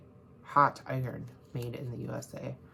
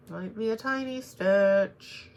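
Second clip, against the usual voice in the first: higher, louder, slowed-down Might be a tiny stitch; a playful higher repeat of tiny stitch; mock dramatic; 5 semitones higher, 5 dB louder, slower than usual. Real, not acted. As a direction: stretch it out like a dramatic warning. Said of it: "so it might be a tiny stitch".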